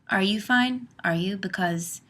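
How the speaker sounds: normal talking voice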